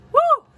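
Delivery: short, very high, loud "woo"; playful whoop. Pretend. Child cheering during play, high whoop. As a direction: let out a piercing little whoop.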